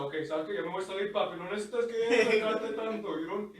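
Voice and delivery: high, loud Spanish, a bit slurred; spaced-out guy. Retold or imitated. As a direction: play the high guy brushing them off. Voicing the drugged man.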